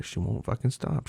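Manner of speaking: ordinary delivery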